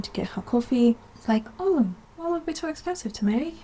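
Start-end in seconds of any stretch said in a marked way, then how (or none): 1.6-3.6 high, soft voice butting in; mock-innocent high-pitched interjection; imagined Emerald. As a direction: lightly pipe up like a curious passer-by. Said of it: "Emerald walking by".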